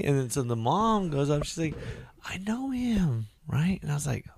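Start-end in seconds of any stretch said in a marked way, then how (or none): 2.2-3.3 high, slow, soft mom voice; imitating the mom. Mom revealing she knows him, high slow voice. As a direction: drawl it sweetly like mom.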